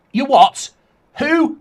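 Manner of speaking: loud, high disbelieving outburst; incredulous you what. Pretend. Fan hears the club's answer, loud and incredulous. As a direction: bark it in disbelief.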